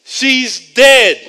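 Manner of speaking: loud, high-pitched announcement of a death; blunt bad news. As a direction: blurt the news out loudly. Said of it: "They come back".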